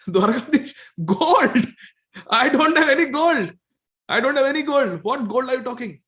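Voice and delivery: high, loud amused protest; laughing disbelief. Acted. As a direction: laugh off the idea of having gold. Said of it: "he's, he's laughing".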